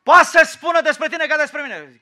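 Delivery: loud, high preacher's shout; sudden high-pitched yell to the crowd; shouted outburst. Real, not acted. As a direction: burst out at full volume. Outburst after talk of being unloved.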